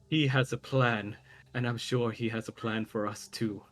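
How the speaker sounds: lightly voiced uptight retainer; a touch prim, admonishing; uptight man. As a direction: play a mildly stuffy, self-important man. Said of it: "sounds like a very uptight man".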